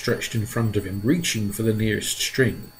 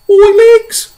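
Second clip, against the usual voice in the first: very high, loud furious scream; angry scream; 15 dB louder than usual. A narrator's reading. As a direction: scream in outrage. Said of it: "The centipede screamed with anger".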